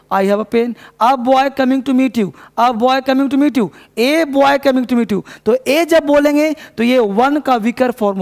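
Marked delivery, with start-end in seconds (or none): 1.0-5.2 slow and deliberate, "a" said as "ay"; slow stressed drill. Shown as a demonstration. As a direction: drill it word by word, slowly. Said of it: "उस चीज़ को आप stress करना".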